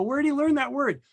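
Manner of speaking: high-pitched mock bewilderment; feigned puzzlement. Acted feigned puzzlement to onlookers.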